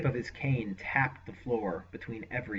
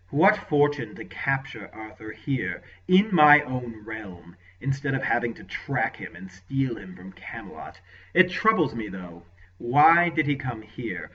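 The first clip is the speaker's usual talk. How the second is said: a hint of a gloating villain; musing lord; 8 dB louder than usual. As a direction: lord gloating over Arthur's capture. Lord muses after laughing.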